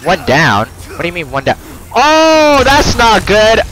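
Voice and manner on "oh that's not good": high, loud alarmed cry; alarmed shout. Real, not acted. Gamer getting comboed by villagers, alarmed loud shout. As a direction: shout as things go wrong fast.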